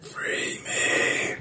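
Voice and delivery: unvoiced breathy ghost plea; ghostly whisper. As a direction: whisper like a trapped spirit. Ghost speaks.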